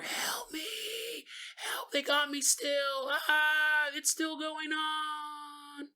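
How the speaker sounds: high, very soft cries for help from far above; faint far-off cries. Acted. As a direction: sound distant and small, shouting from the sky. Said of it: "just a little bit more".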